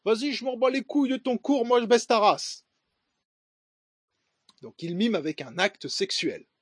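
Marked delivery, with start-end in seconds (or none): 0.0-2.6 higher-pitched imitation of a French banlieue accent; French suburb street slang. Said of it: "c'est l'accent de banlieue".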